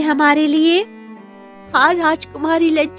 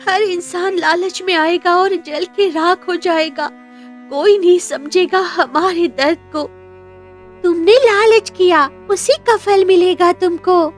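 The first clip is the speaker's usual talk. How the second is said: high, tearful wail of warning; eerie, high-pitched lament; weeping statue; 8 semitones higher than usual. Acted. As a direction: pronounce a curse through mournful tears. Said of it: "रोने लगती है".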